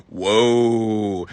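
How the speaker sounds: low, long, woozy voice; dizzy groan. Retold or imitated. Concussed uncle as the hospital doors open, woozy. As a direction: drop deep and draw it out woozily.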